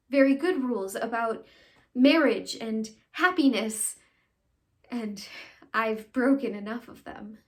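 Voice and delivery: loud, emphatic self-reproach; dramatic monologue. Acted. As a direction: punch the key words like a stage confession. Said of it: "I'll be doing a monologue".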